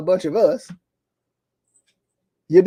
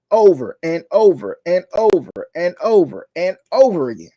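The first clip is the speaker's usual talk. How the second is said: ordinary talk, nothing marked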